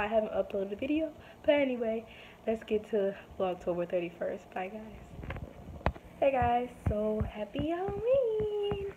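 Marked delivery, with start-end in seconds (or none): 6.2-8.9 high, slow, drawn-out greeting; cheery greeting. Real, not acted. Vlogger greets viewers on Halloween, high drawn-out cheer. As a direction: stretch the words out high, like a cheer.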